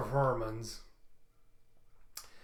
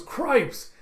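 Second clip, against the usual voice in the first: high, loud startled exclamation; startled yelp; 11 semitones higher, 9 dB louder than usual. Acted. Character startled by something flying into the ground, startled cry. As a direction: burst out loud and high in alarm.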